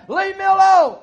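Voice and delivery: loudest, highest peak of the yell on alone; top-of-the-lungs roar; furious shout. Retold or imitated. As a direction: scream it to throw him out. Offended man yells at preacher.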